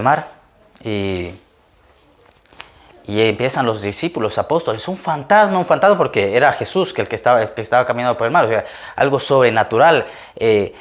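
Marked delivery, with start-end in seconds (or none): 4.9-6.0 higher-pitched imitation of startled disciples; mild mock alarm. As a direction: lightly act out a startled cry. Apóstoles asustados al ver a Jesús. (susto levemente imitado)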